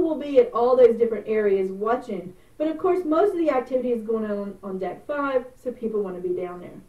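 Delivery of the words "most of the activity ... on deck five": ordinary delivery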